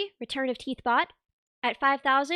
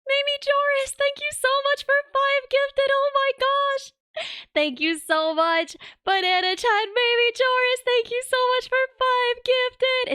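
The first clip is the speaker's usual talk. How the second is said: high-pitched excited thanks; excited squeal; 10 semitones higher, 4 dB louder than usual. Real, not acted. Excited thanks for gifted subs.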